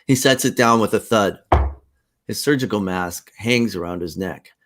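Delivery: normal talking voice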